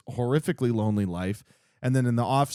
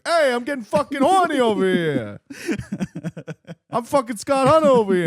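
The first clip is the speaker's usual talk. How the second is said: much higher, much louder Brooklyn tough-guy bark; horny Brooklyn accent; 12 semitones higher, 7 dB louder than usual. Retold or imitated. Hockey player Kip gets turned on with Scott, loud Brooklyn accent. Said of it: "his Brooklyn accent comes out".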